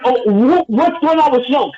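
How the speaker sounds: high-pitched mock yell at the screen; retold yelling. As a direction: act out heckling the movie in disbelief. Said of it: "I'm yelling in the movies like".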